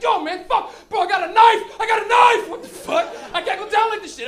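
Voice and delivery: high, very loud panicked shouting; freaking out. Acted. Back-seat guy freaking out as cops follow, panicked shouting. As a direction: yell in full-blown acted panic. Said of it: "Everybody's freaking out".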